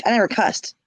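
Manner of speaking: ordinary talk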